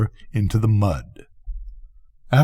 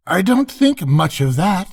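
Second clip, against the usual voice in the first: higher, unimpressed duck voice; lightly voiced unimpressed duck; dismissive duck; 10 semitones higher than usual. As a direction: shrug it off as the duck. Duck character speaks.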